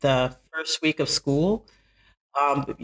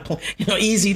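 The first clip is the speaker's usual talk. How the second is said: usual speaking voice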